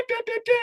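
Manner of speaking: high sing-song da da da; imitated soundboard jingle. Streamer to chat, mocking his missing soundboard with a high sing-song. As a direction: chirp it way up like a soundboard chime. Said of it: "how you do the da".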